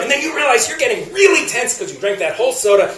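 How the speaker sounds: ordinary talk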